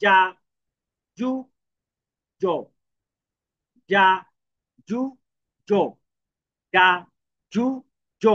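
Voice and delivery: যা, জু, জো said as ja, ju, jo with short pauses between; teacher repeating যা জু জো; syllable drill. Shown as a demonstration. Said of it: "তাহলে কি হবে?".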